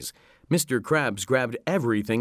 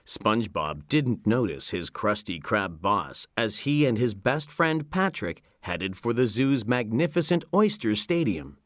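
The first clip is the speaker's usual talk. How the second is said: ordinary delivery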